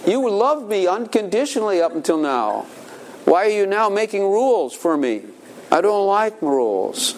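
put-on high childish protest; mock child complaint; complaining child. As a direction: pout like a kid. Child protesting parents' new rules.

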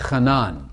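Hanan said with a throaty h at the start, ha-NAN; guttural Hebrew Hanan, stress on the end; Hebrew pronunciation. Shown as a demonstration. Teaching the class to say Hanan with a throaty Hebrew h. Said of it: "Say it".

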